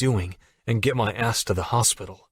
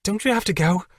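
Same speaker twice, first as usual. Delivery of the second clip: much higher, flustered push; 10 semitones higher than usual. Acted. Allie shooing Jack to work, much higher and flustered. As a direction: hurry him off, embarrassed. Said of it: "now flustered".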